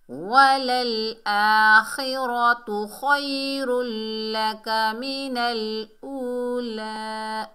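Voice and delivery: slow melodic chant with long held vowels; Quran recitation. Shown as a demonstration. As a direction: chant each phrase slowly and stretch the ends. Verse recited after next prompt.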